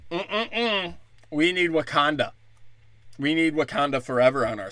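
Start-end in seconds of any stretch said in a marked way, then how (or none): 0.1-1.0 mock hum of refusal. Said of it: "And they're like".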